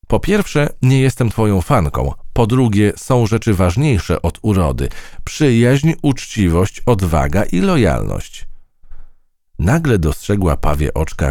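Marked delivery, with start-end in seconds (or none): 0.1-8.5 a touch of a high, formal recital; rehearsed speech. A narrator's reading. Mouse rehearsing her speech for the butterfly, formal. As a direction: list the points like a solemn speech. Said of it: "Ułożyła sobie nawet krótkie przemówienie".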